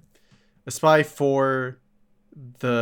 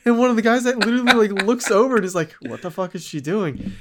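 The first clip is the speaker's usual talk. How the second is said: higher and louder, incredulous retelling; excited disbelief; 10 semitones higher, 5 dB louder, faster than usual. Real, not acted. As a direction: tell it like you can't believe it. Excited retelling of absurd scene.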